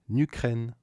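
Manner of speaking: usual speaking voice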